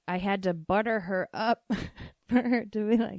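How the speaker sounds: ordinary talk, nothing marked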